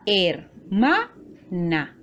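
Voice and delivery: hermana slowly split into her, ma, na; syllable by syllable. Shown as a demonstration. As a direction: say hermana in three separate, drawn-out syllables. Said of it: "está formada por tres sílabas". (hermana, lento y separado en sílabas)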